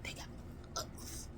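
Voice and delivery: barely voiced, secretive whisper. Child warning mom in the bathroom, whispering. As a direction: whisper it so nobody outside hears. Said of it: "Now I learned how to whisper instantly".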